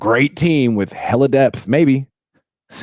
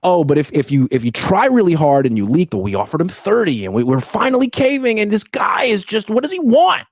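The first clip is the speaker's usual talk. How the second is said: outraged fans; 8 semitones higher than usual. Retold or imitated. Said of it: "They're like".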